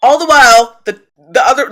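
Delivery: usual speaking voice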